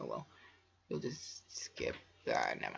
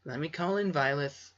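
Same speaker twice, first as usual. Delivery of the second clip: plain reading voice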